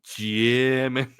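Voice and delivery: "German" said in a strange put-on way with a shifted vowel; a quirky mangled "German"; odd German. Real, not acted. Describing his new German media book, he mangles the word "German". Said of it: "I don't know why I said German like that".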